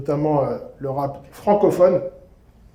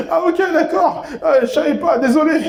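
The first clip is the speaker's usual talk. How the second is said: put-on much higher, sheepish young voice; embarrassed apology; 10 semitones higher than usual. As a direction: chirp an awkward sorry.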